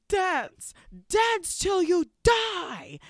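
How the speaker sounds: very high, loud, slow eerie command; sinister witch. Retold or imitated. Witch cursing the party, high sinister croon. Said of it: "But she's like".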